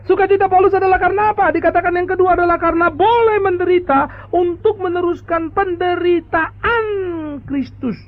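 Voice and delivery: high, loud preacher's climax; fiery preaching. Acted. Pastor exhorts the congregation about Paul's joy, fiery. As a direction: proclaim it from the pulpit.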